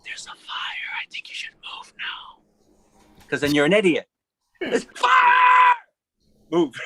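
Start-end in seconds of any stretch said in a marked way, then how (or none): 0.0-3.3 slow, hushed non-urgent warning; very soft, unhurried whisper; absurdly calm whisper. Shown as a demonstration. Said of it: "you don't yell to a person". 4.6-6.7 high, loud panicked scream; piercing emergency shout; yelled alarm. Shown as a demonstration. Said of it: "you don't yell to a person".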